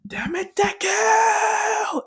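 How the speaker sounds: high, drawn-out acted yell; imitated enraged high shout; furious scream. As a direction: scream the rival's name, stretching it out. Acting out Bakugo's angry scream.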